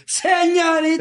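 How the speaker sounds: very high, drawn-out exclamation; hammed-up outraged cry; mock indignant correction. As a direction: imitate an offended woman correcting a title. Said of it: "que te va a decir".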